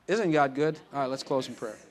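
normal talking voice

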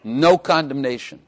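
"No" as the emphatic opening word; stress on No. Shown as a demonstration. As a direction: hit "No" first and hardest. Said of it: "starts out with the word no".

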